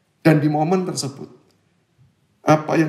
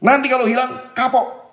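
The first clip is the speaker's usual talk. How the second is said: nagging parent; 6 semitones higher, 3 dB louder than usual. Retold or imitated. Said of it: "orang tua saya langsung berkata".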